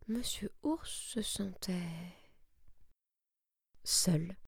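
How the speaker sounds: slow, soft whisper of loneliness; slow tender whisper; whispered sadness. A narrator's reading. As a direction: hush for the sad turn. Emotional climax, bear lonely.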